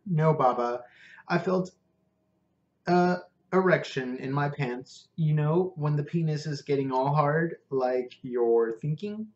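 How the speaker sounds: lightly voiced Indian cabbie, earnest; a hint of an Indian English lilt; Indian accent. Prabhu explains his feeling.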